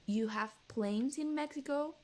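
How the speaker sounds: high, slow astonished question; mock disbelief. Singer can't believe Mexico has planes, mock astonished. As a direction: imitate his stunned disbelief.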